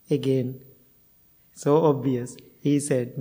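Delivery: usual speaking voice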